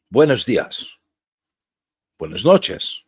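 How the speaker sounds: showing off a little Spanish, lightly accented; Spanish greeting. Shown as a demonstration. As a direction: toss out a casual Spanish greeting. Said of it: "See, I know Spanish".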